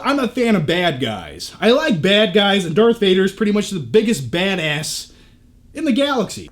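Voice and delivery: normal talking voice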